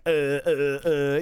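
imitating someone lost for words; halting, dumbfounded mock stammer; clueless stammer. Usually confrontational person confronted back, stammering cluelessly. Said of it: "has no idea what's going on".

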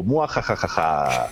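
slow villain cackle; evil laugh. Retold or imitated. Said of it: "Luego Vane contesta".

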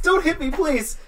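high, loud pleading yelp; playful cry for mercy; mock plea. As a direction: beg loudly in a high voice. Mock plea during horseplay.

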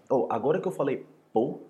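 ordinary delivery

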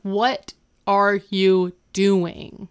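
slow, word-by-word disbelief; drawn-out, emphatic retold question; mock appalled. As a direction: space out each word as an imitated shocked demand. Retold shocked confrontation in shower.

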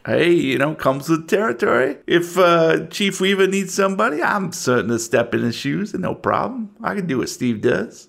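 lightly voiced self-assured deputy; a local deputy angling for promotion; a hint of small-town lawman; folksy deputy. Game master voices local deputy.

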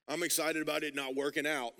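low, glum mock congregant; hammed-down, joyless pretend excitement; mock miserable. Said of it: "something you're excited about that's miserable".

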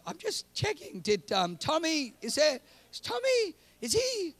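high, flustered stammering questions; nervous stammer. Retold or imitated. Husband fumbling an answer to his wife, nervously high. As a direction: squeak it out like a guilty husband caught.

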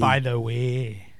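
slow low drawn-out Glaswegian "By the way"; Glaswegian accent. Shown as a demonstration. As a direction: drawl "By the way" in thick Glaswegian. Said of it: "That's a fucking incredible accent".

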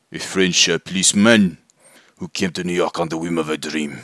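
hammy, badly faked French accent. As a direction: put on a terrible French accent. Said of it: "maybe I'll do a bad accent".

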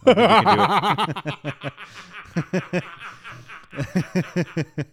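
high, loud, slow words through laughter; helpless laughing. Real, not acted. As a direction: barely get the punchline out for laughing. Laughs through retold punchline.

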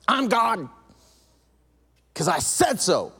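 stern mock-parent voice; bossy parent God. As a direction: lay down the law like a bossy parent. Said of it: "that parent that's like".

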